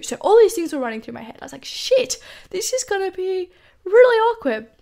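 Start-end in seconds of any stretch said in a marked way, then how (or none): 1.7-4.7 alarmed thought. Retold or imitated. Realising the awkward situation.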